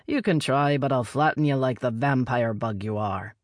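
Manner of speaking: plain reading voice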